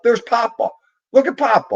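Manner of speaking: very high and loud, thrilled for the dog; excited pet talk. Real, not acted. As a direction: gush at the dog like it's a treat. Showing dog its owner on screen.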